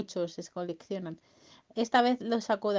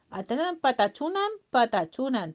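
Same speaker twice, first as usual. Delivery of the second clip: invented-language local; 4 semitones higher, 5 dB louder than usual. Acted. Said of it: "hablaba muy raro".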